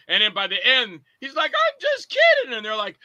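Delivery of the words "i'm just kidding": very high, stretched-out pleading; whiny backpedaling. Acted. Movie character begs off as the girls turn on him, high whine. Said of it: "and he's crying".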